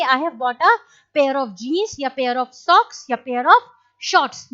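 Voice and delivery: slow, spaced-out English example sentence; teacher dictating an example; slow dictation pace. Shown as a demonstration. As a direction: dictate each word for students to copy. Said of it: "teacher dictates examples slowly".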